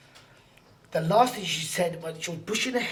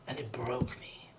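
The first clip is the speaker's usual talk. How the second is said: slow, lower, whispered grief; broken whisper; 6 semitones lower, 9 dB quieter, slower than usual. Real, not acted. A man recalls the girl's words that broke him, whispering. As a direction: let the grief take the voice down to a whisper.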